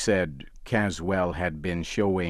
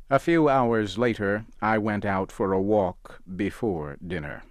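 ordinary delivery

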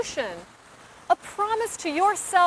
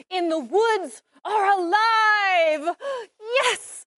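excited; 5 semitones higher than usual. Real, not acted. Thrilled at getting the part.

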